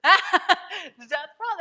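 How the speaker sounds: ordinary delivery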